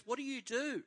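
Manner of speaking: imitated girl, pitched high; random girl. Random girl pressing a stranger about work, high-pitched imitation. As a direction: repeat the question in a high put-on girl's pitch. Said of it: "She goes".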